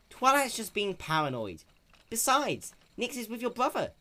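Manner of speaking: put-on high, breezy young dragon; dismissive Spike. Spike dismissing Twilight's worry to Applejack, breezy. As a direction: brush it off lightly.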